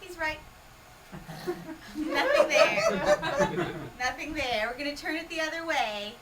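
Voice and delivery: ordinary talk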